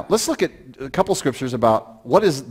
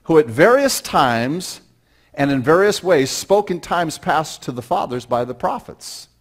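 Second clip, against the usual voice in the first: higher, louder, slow scripture reading; preaching at full force; 5 semitones higher, 7 dB louder than usual. Acted. A pastor preaching the proverb to his church, loud and slow. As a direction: proclaim the verse to the congregation.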